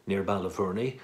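ordinary talk

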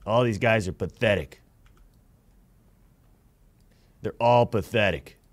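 low-pitched, scornful dismissal; low contempt. Real, not acted. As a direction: write them all off in a low voice. Scorns the other players after losing.

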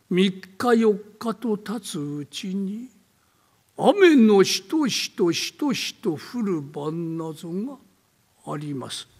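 slow, hushed eerie telling; slow creepy storyteller voice; spooky ghost story narration. Acted. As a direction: draw out the dread of a rainy night. Old man tells ghost story.

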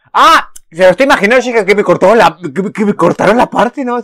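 high, loud excited rant; loud, high excited rush of words; excited yelling. Real, not acted. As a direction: burst out yelling at the screen. Excited reaction to scene.

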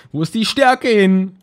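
slow, high, loud lament; exasperated cry. Real, not acted. Gamer finds no strength items, crying out in exasperation. Mock despair at missing items.

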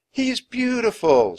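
high, loud wonder; awed admiration. Acted. Annie admiring the sea serpent, awed. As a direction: gush with wonder.

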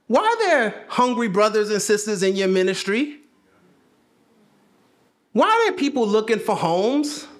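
frustrated Jesus. Acted. Jesus scolding his disciples, building exasperated cry. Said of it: "Jesus looking down ... like".

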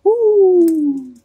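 high owl hoot. A narrator's reading. Owl trying to crow but only hooting high. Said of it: "he just hooted like an owl".

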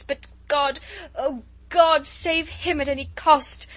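loud, high, frantic prayer; desperate pleading. Acted.

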